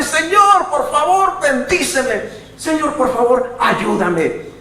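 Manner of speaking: high, loud pleading cry; loud plea. Pretend. Preacher acting a believer crying out, loud high plea. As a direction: plead with the Lord at the top of the voice.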